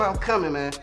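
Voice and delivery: ordinary talk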